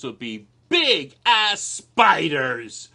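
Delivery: slow, loud movie trailer boom; dramatic announcer. Acted. Unveiling the fifth house, in a slow booming trailer voice.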